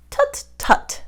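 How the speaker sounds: high breathy tut-tut. Acted. Doctor dismisses Gertrude, mild tutting. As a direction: scold gently like a doctor. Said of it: "said the doctor".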